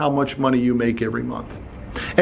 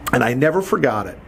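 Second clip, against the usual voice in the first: ordinary talk, nothing marked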